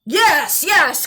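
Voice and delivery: eager, rising cry; cried out. A narrator's reading. Old man cries yes to the varmint, eager and rising. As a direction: jump at the chance. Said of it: "cried the old man".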